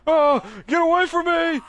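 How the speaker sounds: high, loud frightened scream; terrified scream. Acted. Victim screams at the attacking zombie, terrified. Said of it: "fun making the zombie noises".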